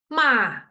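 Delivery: Ma falling sharply, held a bit longer; fourth tone. Shown as a demonstration. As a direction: let Ma drop high to low. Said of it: "from high to low".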